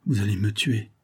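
usual speaking voice

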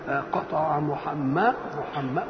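slow reading, stretching the end of "محمد" and stalling; hesitant novice reader. Acted. Boy trying to recall the grammar rule as he reads, hesitant. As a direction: stumble softly, stuck before the last sound. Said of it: "يا عيني يتلخبط".

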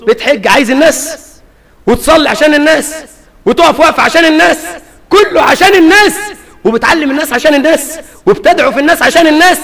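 taunting a hypocrite, pitch climbing; mocking. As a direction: needle the show-off, building. Mocking the show-off worshipper.

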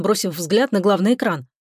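ordinary delivery, nothing marked

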